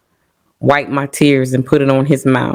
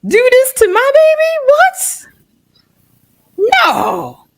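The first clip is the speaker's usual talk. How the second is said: desperate plea to God. Retold or imitated. Preacher's kid mother pleading with God over her child. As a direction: cry out to God in disbelief.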